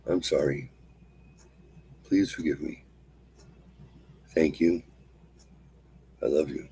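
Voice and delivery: quiet, falling prayer-like repetition, ending in a whisper; soft mantra recitation. Acted. Teacher guiding viewers through the cleansing mantra, soft and prayerful. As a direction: recite the mantra gently to yourself.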